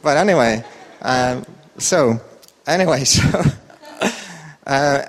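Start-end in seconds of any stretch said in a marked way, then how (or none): none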